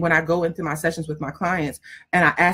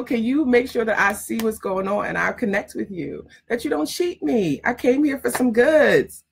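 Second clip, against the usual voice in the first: normal talking voice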